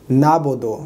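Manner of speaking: "नाबुदु" without the ayn; smooth "naabodo" for "नाबुदु"; common mistake. Shown as a demonstration. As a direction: say "नाबुदु" with no throat consonant. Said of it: "आइन नहीं पढ़ते".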